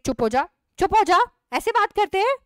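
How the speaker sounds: loud, high rebuke; stern hush. Acted. Mother loudly rebukes her daughter for rudeness.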